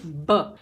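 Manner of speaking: ब with lips nearly closed, a little pressure, air released; lip burst b. Shown as a demonstration. Teacher correcting the wrong way: ब pushed out from closed lips. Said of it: "होंठ थोड़ा बंद करके ... हवा छोड़ेंगे".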